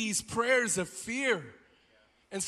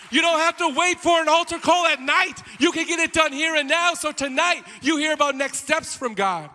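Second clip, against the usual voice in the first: loud, high altar-call shout; full-force preaching; 8 semitones higher, 8 dB louder than usual. Acted. Preacher calling people to act now, full-force preaching. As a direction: press the congregation with raised voice.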